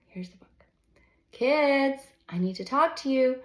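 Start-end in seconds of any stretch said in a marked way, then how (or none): 1.3-3.4 a hint of a mom calling, louder; read-aloud mom calling the kids; mom shouting. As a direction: call up the stairs to the kids, lightly. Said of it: "shouts Mom from the top of the stairs".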